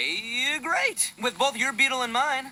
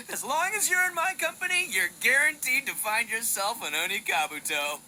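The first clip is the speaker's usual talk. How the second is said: normal talking voice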